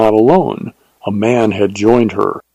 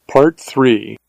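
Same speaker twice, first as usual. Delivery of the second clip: plain reading voice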